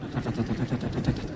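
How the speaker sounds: low, soft ta-ta-ta of the coaster climbing the lift; coaster clacking noise. Retold or imitated. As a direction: click out the chain lift under your breath.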